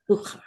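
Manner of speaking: "uchar" with the ch made in the back of the throat; throaty Hebrew "ch". Shown as a demonstration. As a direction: say "uchar" as a teacher's model, ch deep in the throat. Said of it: "Get that in the back of your throat".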